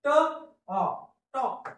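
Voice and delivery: stretched vowel into the word; slow phonics blend. Shown as a demonstration. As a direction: draw it out, then blend. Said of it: "कसा उच्चार होईल".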